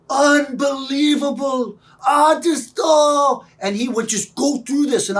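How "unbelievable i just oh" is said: high, loud, drawn-out dad exclamation; imitated overcome dad. Dad marvelling at his child, imitated overcome cry. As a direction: cry it out high and slow, imitating an amazed father. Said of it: "I would remember his".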